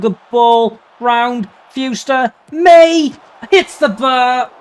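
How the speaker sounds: builds from lively to a high, loud, stretched cry; rising excitement. Real, not acted. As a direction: climb with the attack, then draw out the miss. Live commentary chance builds.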